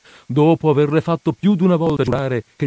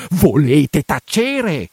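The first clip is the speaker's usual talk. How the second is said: higher, louder, slow cutting-off; frantic hushing; 5 semitones higher, 4 dB louder, much slower than usual. Acted. Priest cutting off his housekeeper, frantic hush. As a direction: interrupt her sharply. Interrupts her advice again.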